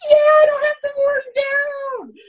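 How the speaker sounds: high, loud, drawn-out whoop of relief; imitated elated cheer. Swimmer told he can skip warm down, imitated elated cheer. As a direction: cheer it out in mock jubilation. Athlete's elated echo of coach.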